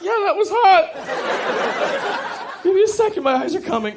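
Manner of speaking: very high, loud, drawn-out strained voice; pained squeal. Acted. Slapped man pretending he liked it, pained squeal. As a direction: squeal agreement through mock pain.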